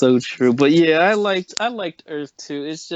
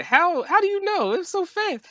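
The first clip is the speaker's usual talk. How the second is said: shocked disbelief. Retold or imitated. Mocking a character's disbelief.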